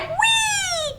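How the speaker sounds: very high, squealing frog "Wee"; frog's happy cry. Acted. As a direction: let the frog squeal with joy. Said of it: "Frog cried".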